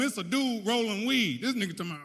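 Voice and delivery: ordinary talk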